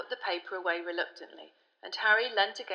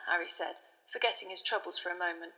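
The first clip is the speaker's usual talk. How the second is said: ordinary delivery, nothing marked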